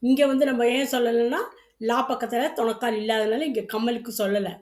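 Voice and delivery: usual speaking voice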